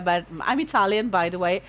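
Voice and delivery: usual speaking voice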